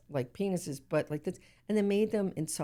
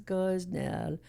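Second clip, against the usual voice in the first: lilting, melodic name, very chill; sing-songy voice. Retold or imitated. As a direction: sing the name gently and relaxed. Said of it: "he had this beautiful sing-songy voice like this".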